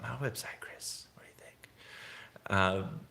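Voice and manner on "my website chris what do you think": fast, unvoiced whisper; whispered aside. Real, not acted. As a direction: lean in and whisper the request. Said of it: "whispered aside to Chris".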